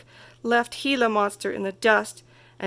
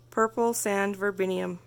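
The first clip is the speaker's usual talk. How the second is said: ordinary delivery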